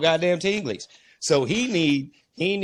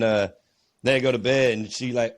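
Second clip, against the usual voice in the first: normal talking voice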